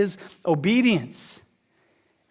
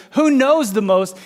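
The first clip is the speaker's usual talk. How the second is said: louder, higher, slow question; mock judging churchgoer; 5 semitones higher, 5 dB louder, slower than usual. Churchgoer looking around the room, louder mock question. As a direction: size up the room. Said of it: "look around this room and goes".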